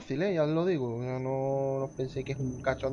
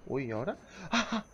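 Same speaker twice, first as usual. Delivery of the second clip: ordinary delivery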